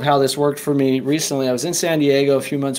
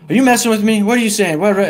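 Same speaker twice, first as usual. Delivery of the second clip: agitated demand; 7 semitones higher, 4 dB louder, faster than usual. Retold or imitated. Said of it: "And he's like".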